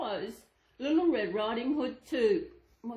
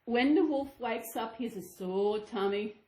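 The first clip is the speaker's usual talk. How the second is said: ordinary delivery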